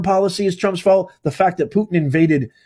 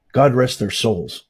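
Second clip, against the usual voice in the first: ordinary talk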